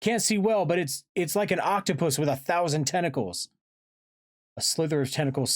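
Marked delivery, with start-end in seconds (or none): 0.0-3.5 high, urgent report; yelled down. A narrator's reading. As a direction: call the alarm down from above. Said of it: "Tobias yelled down from above".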